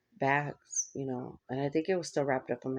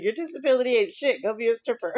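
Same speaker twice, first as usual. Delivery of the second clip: high, louder blunt mom impression; mock mom; 7 semitones higher, 4 dB louder than usual. Daughter mimicking mom's blunt advice, high and loud. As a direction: bark mom's advice, half laughing.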